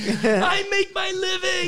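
high, slow mock sobbing lament; very high wailing impression; imitated anguished wail. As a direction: drag it out in hammed-up despair. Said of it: "And Eric's going".

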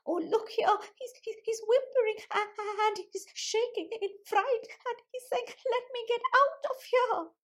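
acting out a frightened boy's plea to get out; dramatic read-aloud voice. Shown as a demonstration. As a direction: read it to children with wide-eyed drama, pitch way up. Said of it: "I'm going to read it, uh, by saying something like".

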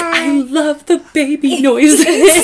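slow, high, gushing fan. Retold or imitated. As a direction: gush like a smitten listener. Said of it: "but one of them's like".

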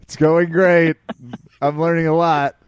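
hammed-up happy student selling the class; mock cheerful student.

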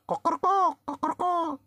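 very high crowing cock-a-doodle; rooster crow. A narrator's reading. Roosters charging the little man, with a high crowing cry. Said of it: "అని అరుస్తా".

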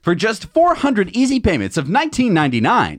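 high, loud, hyped TV pitchman; infomercial voice. Pretend. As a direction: sell it like a late-night infomercial. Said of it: "if you wanna do an infomercial".

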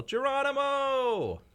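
high, drawn-out jumping yell; stretched-out high holler; mock battle yell. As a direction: holler it long and high, like leaping off something. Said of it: "they'd yell".

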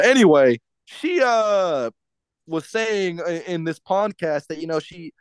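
usual speaking voice

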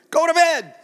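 put-on dad ordering his kids to bed, loud and high; parent ordering kids. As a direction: bark it at the kids like a stern parent. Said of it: "Like I tell my kids".

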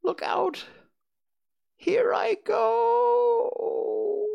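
very high, loud screech stretched into a fading wail; dying witch's shriek. A narrator's reading. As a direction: scream your last, drawing out the final word. Witch's last cry as she melts.

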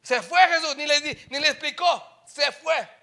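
loud high preaching; fiery preaching. Acted. Pastor telling the church Jesus left, preaching loud. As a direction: hammer it home.

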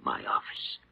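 hushed, voiceless disbelief; stunned whisper. Acted. Doctor finding his office redone, stunned whisper. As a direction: barely get it out in shock.

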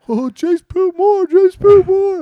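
very high, loud, drawn-out squeal; excited little brother. Retold or imitated. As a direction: imitate a kid shrieking the news.

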